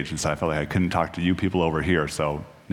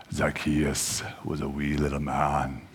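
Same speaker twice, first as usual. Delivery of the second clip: slow, low, softer dramatic reading voice; 4 semitones lower, 5 dB quieter, slower than usual. Shown as a demonstration. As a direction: read it like grand theatre, slowly. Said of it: "I could do a dramatic reading of this".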